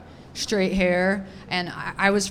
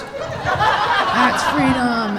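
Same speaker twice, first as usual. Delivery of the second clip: laughing; 6 semitones higher, much slower than usual. Retold or imitated. Kid's smug conclusion, laughing.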